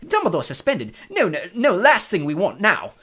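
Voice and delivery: high, loud, flustered protest; alarmed. A narrator's reading. Fudge protests Dumbledore's suspension, alarmed. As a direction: protest in rising panic. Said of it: "looking alarmed".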